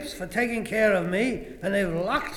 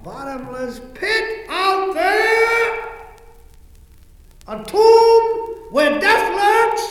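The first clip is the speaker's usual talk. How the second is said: loud, high, slow horror; dread; 12 semitones higher, 9 dB louder, slower than usual. Acted. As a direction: cry out in dread. Dread at dark theater.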